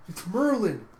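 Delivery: high, loud cry from afar; acted shout to companions; calling out. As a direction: summon friends urgently from above. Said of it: "you hear a cry from above".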